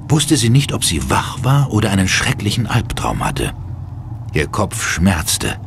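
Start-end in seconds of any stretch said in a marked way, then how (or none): none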